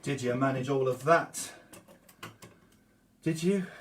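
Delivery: breathless after singing and moving; panting slightly between words; out of breath. Real, not acted. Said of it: "I'm about out of breath".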